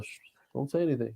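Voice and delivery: quick, soft shushing aside; hushed warning. Retold or imitated. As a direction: hush him like a secret. Hushed quote about a secret.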